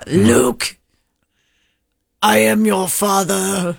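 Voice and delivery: Froggy-style Vader. Acted. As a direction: mash Darth Vader into Froggy from Little Rascals. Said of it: "Star Wars and Little Rascals together".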